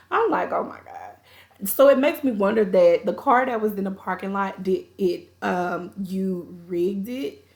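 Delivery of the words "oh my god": low, slow, quiet dismay; hushed, drawn-out disbelief; appalled aside. Retold or imitated. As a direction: let it sink down slowly under your voice. Own retold reaction to stolen car.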